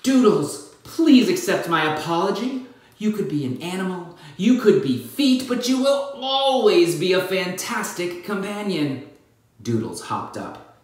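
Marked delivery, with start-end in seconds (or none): 0.0-9.1 pleading apology. Acted. Apologizing to her pet.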